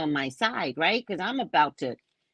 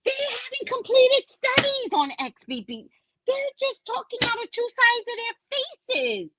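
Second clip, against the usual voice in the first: very high, exasperated rant; high-pitched indignant outburst; outraged squeal. Real, not acted. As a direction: protest in a straining high voice. Outraged at the news report.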